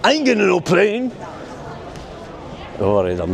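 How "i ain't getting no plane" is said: a lightly voiced Mister T, a bit higher; gruff tough-guy quote tossed off in passing; Mister T impression. Vlogger quoting Mister T at a mural, lightly voiced. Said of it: "there's Mister T".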